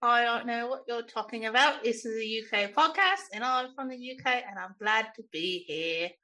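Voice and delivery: put-on British accent, playfully insistent; silly British accent. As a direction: bluff in a mock British accent. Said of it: "you can drop that silly accent".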